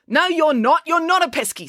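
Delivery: high-pitched, indignant snap; snappy retort. A narrator's reading. April rejecting Loretta's claim to be family, snapping. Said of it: "snapped April".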